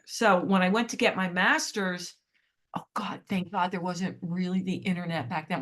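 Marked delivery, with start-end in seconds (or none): 2.8-5.6 hushed whispered relief; whispered aside. Real, not acted. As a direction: whisper it like a secret confession. Aside relief remark, unvoiced.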